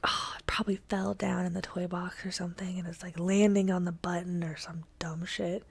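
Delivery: hushed whisper of a grandma in the dark. Retold or imitated. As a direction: whisper it so nobody wakes. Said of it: "my grandma was like".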